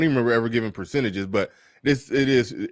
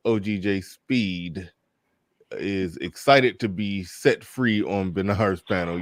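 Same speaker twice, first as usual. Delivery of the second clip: slow chat read; 3 semitones lower, much slower than usual. Shown as a demonstration. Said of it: "shout out to Paul Game, says".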